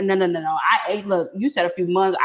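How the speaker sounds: ordinary talk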